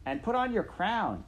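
high, coarse servant voice; shrill servant woman. Acted. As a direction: bark it like a crude maid. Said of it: "said Midge".

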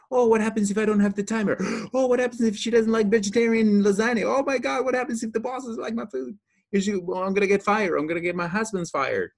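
high, fast, frantic worrying; panicky overthinker. Retold or imitated. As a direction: spiral into breathless panic. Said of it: "I think she overthinks too much".

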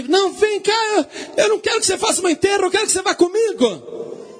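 high-pitched, fast desperate cry; frantic acted begging, voice shooting up; desperate plea. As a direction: call after someone leaving, pleading at full pitch. King begs departing wife to stay.

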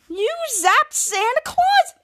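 high, slow disbelieving cry; mock horrified high question; shocked question. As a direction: stretch it out in disbelief. Kids shocked at news.

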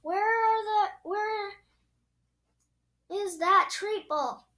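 high, loud impatient demand; shouting. A narrator's reading. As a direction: shout the question across the house. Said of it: "shouted Eugenia".

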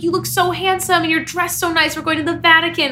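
high, eager compliment; retold gushing. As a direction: gush it, quoting yourself. Quotes gushing compliment to Jake that morning.

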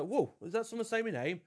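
high, soft, puzzled mock reaction; mock surprise. Person named [name] mishearing a sneeze, mock surprise. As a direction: act taken aback, high and hushed. Acting out mistaken name call.

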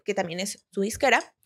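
ordinary talk, nothing marked

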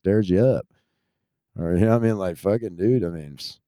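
ordinary talk

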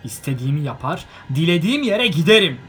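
loud, high and defiant; stubborn. A narrator's reading. Bilbo stubbornly defying Gandalf, loud and high. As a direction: dig in, refuse to budge. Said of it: "dedi Bilbo dik kafalılıkla".

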